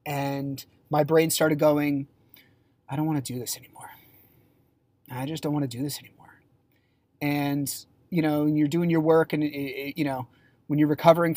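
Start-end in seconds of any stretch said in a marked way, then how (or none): 2.9-6.4 hushed, fast unvoiced whisper; inner whisper. Her own mind whispering she wants to quit, soft voiceless whisper. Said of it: "started off almost like a whisper in my head".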